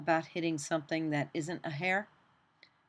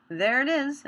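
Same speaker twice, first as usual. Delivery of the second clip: higher and louder, pleased; pleased exclamation; 9 semitones higher, 8 dB louder than usual. Real, not acted. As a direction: cheer the result as it appears. Fill succeeds in the tutorial.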